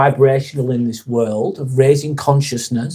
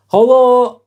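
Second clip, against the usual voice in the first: higher and louder hello called out; loud cheery hello; 10 semitones higher, 6 dB louder than usual. Real, not acted. Streamer calling hello to a newcomer, loud and high. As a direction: sing out a big welcome. Greeting a new chat arrival.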